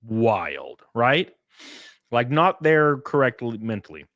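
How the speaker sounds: ordinary delivery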